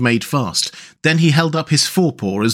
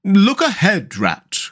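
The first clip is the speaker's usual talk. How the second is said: higher warning that drops on the name; sudden alarmed cry; 7 semitones higher, slower than usual. A narrator's reading. Mole warning Rat before the crash, alarmed. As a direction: cut in with an urgent warning. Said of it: "cried the Mole suddenly".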